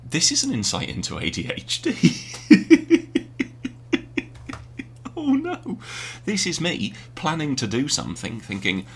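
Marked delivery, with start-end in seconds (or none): 5.2-5.8 high unvoiced burst of laughter; laughing at himself. Real, not acted. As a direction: crack up at your own mess. Laughing at own distraction.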